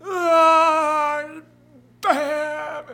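loud pained outburst; anguished cry. Acted. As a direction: cry out in dismay. Randy's hope crushed.

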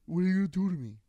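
high, loud, panicky plea; scared little brother. Retold or imitated. Voicing his scared baby brother asking what he'll do, high and pleading. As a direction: play the frightened brother begging.

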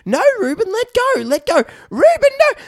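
put-on high, loud child's protest; a dad doing his daughter's shrill no, no; imitating upset daughter. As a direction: play the little girl yelling at her brother. Daughter yelling at brother, retold.

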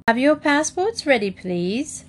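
official request. A narrator's reading. Official-style call to passengers.

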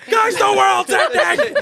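high, drawn-out mock doom cry; mock-panic shout. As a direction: shout it like a hammed-up apocalypse warning. Joking mock panic about doom.

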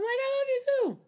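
very high, loud sing-out affection; bubbly reply. Retold or imitated. Replying to friend on morning call, bubbly. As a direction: chirp it back to a bubbly friend.

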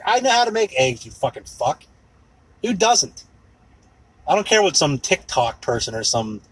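ordinary talk

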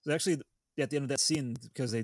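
ordinary talk, nothing marked

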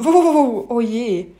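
amused storybook dog; laughing. A narrator's reading. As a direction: whoop with mock laughter, then sigh. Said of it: "Fleckchen muss lachen".

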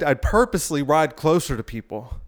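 ordinary delivery

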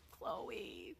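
breathy whispered Chloe, no voice at all; a soft grieving whisper of Chloe; whispered name. Retold or imitated. Mourning the guinea pig's name.

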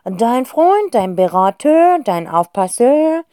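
loud, slow indignant fox. Acted. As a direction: list them with wounded pride. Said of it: "antwortet Monsieur Renan entrüstet". (laut, langsam, entrüstet)

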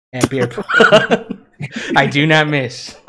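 slow laughing brag. Real, not acted. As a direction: laugh through the boast. Bragging about drunk beer pong.

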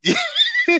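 breaking into laughter; stifled giggles. Real, not acted. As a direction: laugh bursting through. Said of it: "I'm trying not to laugh".

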